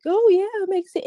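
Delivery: high, airy mock yes-man; mock agreement. Mocking the group, a high sing-song mock agreement. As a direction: toss it off like a sheep nodding along.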